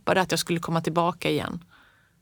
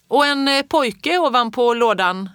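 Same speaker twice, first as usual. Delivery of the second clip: put-on loud high call, slowing on the boy; 6 semitones higher, 6 dB louder than usual. A narrator's reading. Ingeborg calls to Fritjof outside: a loud slow call. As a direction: shout it out the window, teasing. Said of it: "ropade Ingeborg".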